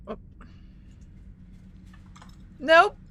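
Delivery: ordinary delivery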